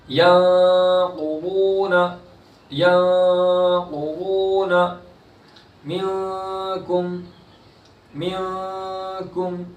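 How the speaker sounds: slow, drawn-out Quranic chanting; tajweed recitation. Shown as a demonstration. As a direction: stretch the vowels in recitation. Teacher recites examples.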